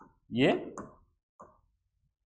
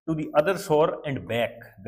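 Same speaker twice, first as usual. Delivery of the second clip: very slow, spelled-out English phrase; slow read-aloud; much slower than usual. Shown as a demonstration. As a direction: drag each word for the learners. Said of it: "rereads English phrase slowly".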